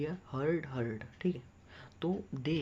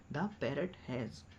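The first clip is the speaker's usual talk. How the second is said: slow dictation; 3 dB quieter, much slower than usual. Shown as a demonstration. Teacher dictates slowly again.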